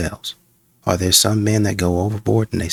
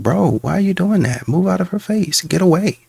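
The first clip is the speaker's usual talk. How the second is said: higher, disbelieving telling-off; incredulous scolding; 8 semitones higher than usual. Retold or imitated. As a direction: call out a creep in disbelief. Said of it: "It's like".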